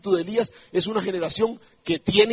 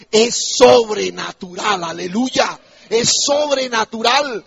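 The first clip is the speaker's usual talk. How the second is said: loud, drawn-out proclamation; emphatic preaching; 3 semitones higher, 8 dB louder, slower than usual. Real, not acted. Preacher assuring congregation of supernatural provision, emphatic preaching. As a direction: stretch SOBRENATURAL out with full force.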